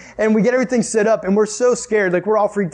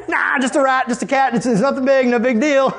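higher-pitched, dismissive patter; nervous self-reassurance; 5 semitones higher than usual. Acted. Camper explaining away creepy noises, nervously reassuring. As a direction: wave the scary noise away, voice up. Talking oneself out of fear.

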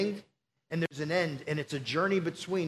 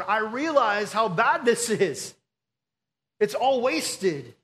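slow, heavy admission of loss, a little louder; anguished confession; 3 semitones higher, 5 dB louder, slower than usual. Retold or imitated. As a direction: confess it to God, weighted and slow. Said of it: "sitting before God going".

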